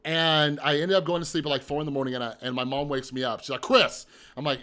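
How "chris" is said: sharp high call of his name. Retold or imitated. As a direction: mom jolting him awake, calling his name loudly. Mom waking him, calling name.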